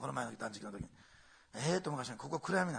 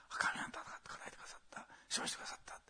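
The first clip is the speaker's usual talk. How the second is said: hushed whisper of thanks; a whispered cry of triumph; whispered victory cry; 12 dB quieter than usual. Acted. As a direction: breathe the words like a secret.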